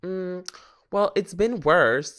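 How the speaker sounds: casual brush-off to herself about her health; dismissive self-talk. Retold or imitated. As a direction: wave it away lightly, up in pitch. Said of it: "'cause I was like".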